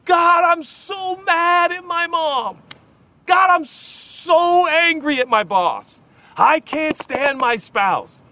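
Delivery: high, slow exasperated complaint; primal cry. Retold or imitated. Pastor voicing a listener's primal cry to God, high slow venting. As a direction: drag it out high like a venting plea. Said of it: "that primal cry".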